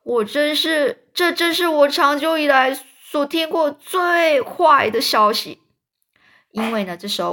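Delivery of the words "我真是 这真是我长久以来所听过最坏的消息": high, loud, drawn-out earthworm moan; dismayed complaint. A narrator's reading. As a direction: lament it loudly in a raised voice. 蚯蚓对坏消息发牢骚. (高声哀怨地抱怨)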